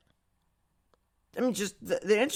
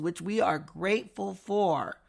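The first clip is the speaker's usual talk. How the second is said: ordinary talk, nothing marked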